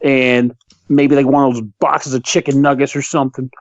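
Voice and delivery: a mild, slowed-down show of good manners; polite order. Retold or imitated. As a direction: order like the nicest customer alive. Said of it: "look the guy in the eye and say".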